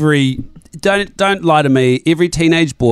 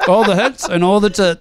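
mouth full; 6 semitones higher than usual. Real, not acted. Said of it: "Sorry, I'm still eating chips".

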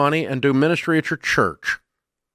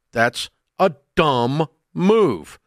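dumb move said slowly, each word weighted; slow emphatic dumb; much slower than usual. Real, not acted. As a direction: spell out the verdict slowly. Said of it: "repeated dumb verdict".

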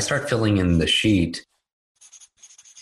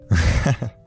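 short laugh; 4 semitones higher, 3 dB louder than usual. Real, not acted. Laughing at his own reaction.

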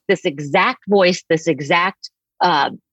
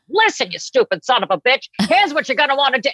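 mock brusque advice columnist, higher voice; Ann Landers, abrupt; 7 semitones higher than usual. Columnist answering people who write for advice, imitated as abrupt and brusque. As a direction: lay into them abruptly, pitched up. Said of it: "she was so abrupt".

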